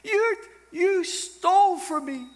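high, loud mock whine; mock the snowflakes; whiny offended people. Mocking offended listeners, high whiny voice. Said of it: "they're like".

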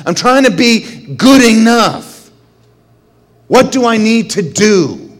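desperate prayer. Retold or imitated. Prays desperately on the ground.

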